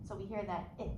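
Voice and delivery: ordinary delivery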